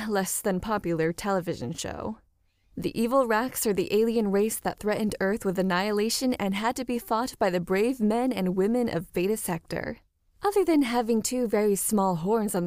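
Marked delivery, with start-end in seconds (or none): none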